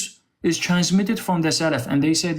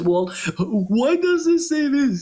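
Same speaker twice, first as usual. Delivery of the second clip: mocking voice; 10 semitones higher than usual. Mocking opponent's question.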